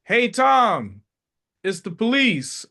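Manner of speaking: high, loud call-out to a friend; mock yelled warning. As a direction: yell a heads-up to someone down the street. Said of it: "and I yell out".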